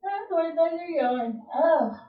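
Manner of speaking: yawning mid-sentence. Real, not acted. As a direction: speak through a yawn. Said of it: "I don't know why I'm yawning".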